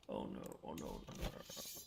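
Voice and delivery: a real dread, muttered under the breath; muttered dismay as enemy troops close in; quiet dismay. Real, not acted.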